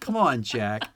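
plaintive childlike calling, lightly imitated; Charles Grodin. Mimicking a man calling from the train bathroom, childlike whine. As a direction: call out like a needy child. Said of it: "he kinda sounds like a child".

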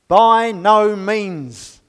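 slow, heavy and loud, every word weighted; emphatic denial. Acted. Reading Paul's answer to the congregation, slow and emphatic. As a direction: shut the question down hard. Paul answers his own question.

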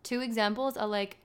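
usual speaking voice

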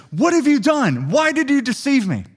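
high-pitched outraged cry; angry yell. Retold or imitated. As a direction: cry out in betrayed fury. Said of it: "he yells at Laban".

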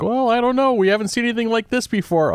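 high-pitched clueless expert voice; mock baffled expert. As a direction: squeak it out like a stumped scientist. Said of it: "and they're like".

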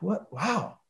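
very soft retold astonishment; quietly stunned aside; hushed amazement. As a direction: murmur it so the sleeper stays asleep. Finding roommate asleep, retold.

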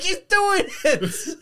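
slow, high, stretched out through laughter; laughing squeal. Retold or imitated. Fan quoting the DiCaprio pointing meme, slow laughing squeal. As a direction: draw it out while cracking up.

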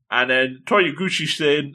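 ordinary delivery

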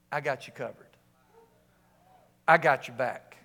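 low, measured divine assurance; God Almighty. Acted. God answers the one going in, low and assuring. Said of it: "Could you imagine God Almighty saying".

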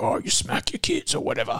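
high whispered mocking voice; whispered high mock quote. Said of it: "made these Outback parents look".

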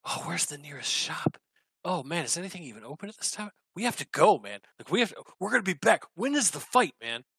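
hurried excitement. Acted. Orc eager to buy potions for the fight, fast excited. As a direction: blurt it out, eager to run off.

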